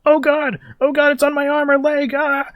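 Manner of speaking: high, loud panicked cries; panicked gang members. Acted. Burned gang members cry out in panic, high and loud. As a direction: yelp in terror at the fire. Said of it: "a bunch of his men are like".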